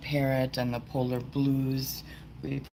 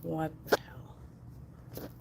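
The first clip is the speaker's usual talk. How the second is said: annoyed whisper; 8 dB quieter, much slower than usual. Real, not acted. Zoom not working live.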